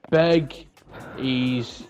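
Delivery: usual speaking voice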